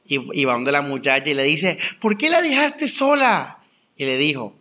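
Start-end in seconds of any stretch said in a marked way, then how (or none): 2.0-3.6 high, slow, anguished cry of blame; a sister demanding why she was left alone; anguished accusation. Retold or imitated. Sister confronts the caretaker.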